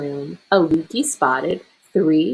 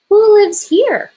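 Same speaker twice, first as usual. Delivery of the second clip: high, loud, slow curious wonder; wondering aloud; 8 dB louder than usual. A narrator's reading. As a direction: marvel at the huge igloo. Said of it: "she wondered".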